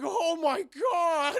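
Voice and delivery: high, loud, long-drawn gasping cry; mock astonishment. Goliath seeing gargoyle Elisa, acted astonishment. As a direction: stretch it out in hammed-up shock.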